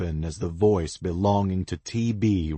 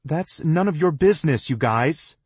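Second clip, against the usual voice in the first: louder, faster, angry; rising anger; 11 semitones higher, 7 dB louder, faster than usual. A narrator's reading. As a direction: snap back, getting angry. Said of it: "Cass's voice is rising in anger".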